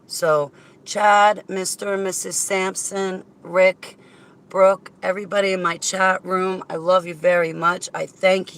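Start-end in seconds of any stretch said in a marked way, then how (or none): none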